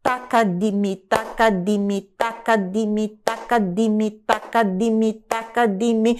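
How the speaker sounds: metronomic "taca de mi", four equal syllables to each beat; steady run of rhythm syllables in fours; four per pulse. Shown as a demonstration. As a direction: recite "taca de mi" evenly on a level pitch. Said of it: "eu vou dividir o pulso por quatro".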